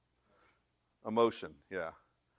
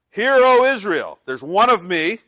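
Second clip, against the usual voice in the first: booming, high-pitched call to a nation; loud proclamation; 8 semitones higher, 14 dB louder, slower than usual. Acted. God addresses Israel, loudly proclaiming. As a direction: proclaim it at full voice.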